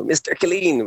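high-pitched mock teacher's call; annoyed teacher. Retelling the teacher he used to wind up, high annoyed imitation. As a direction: imitate an exasperated teacher calling out a pupil. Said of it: "when she'd get annoyed".

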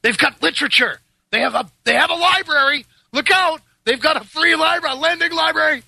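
high-pitched sham dread; mock alarm. Joins in mocking the elite's alarm.

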